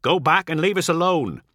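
high, forceful warning; urgent threat, pitched well up. A narrator's reading. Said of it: "Ol warns".

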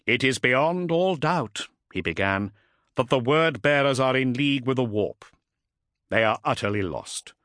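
a hint of formal briefing; Ultramarine captain addressing council. Voices Cestus addressing the council.

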